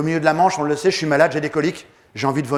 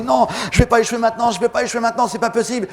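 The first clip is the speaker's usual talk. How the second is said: higher, urgent determination; desperate inner refusal; 7 semitones higher than usual. Retold or imitated. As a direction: refuse to fail, pushing hard. Refus intérieur désespéré. (refus désespéré, voix tendue et plus aiguë)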